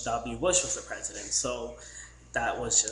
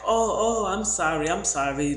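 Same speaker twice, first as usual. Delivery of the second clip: flustered apology; 6 semitones higher, 5 dB louder than usual. Retold or imitated. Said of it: "the guy's like".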